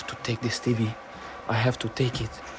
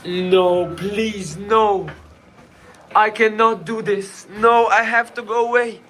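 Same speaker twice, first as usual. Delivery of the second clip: frightened pleading; 11 semitones higher, 7 dB louder, slower than usual. Real, not acted. Pleading with captor.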